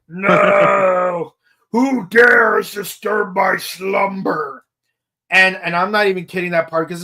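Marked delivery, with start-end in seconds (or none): 0.1-1.3 monster voice. Retold or imitated. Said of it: "It's like". 1.7-4.6 slow, booming monster shout; Gomora. Retold or imitated. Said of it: "It's like".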